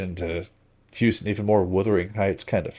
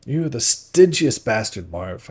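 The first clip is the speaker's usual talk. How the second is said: bitter, slow accusation; laying into him; 3 semitones higher, 6 dB louder, slower than usual. A narrator's reading. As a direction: hammer each word at him. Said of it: "I get stuck into him".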